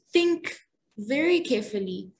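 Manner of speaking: "Think" punched hard and loud, then a pause before "very carefully"; stress on THINK. Shown as a demonstration. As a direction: hit "Think", let the rest follow calmly. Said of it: "If I emphasize the word think".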